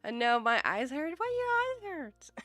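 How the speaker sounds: ordinary talk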